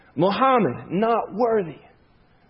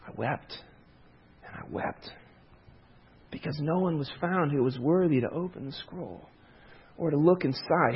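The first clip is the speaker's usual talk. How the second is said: hushed weeping; 3 semitones lower, 9 dB quieter than usual. Retold or imitated. John weeping, quoted in sermon.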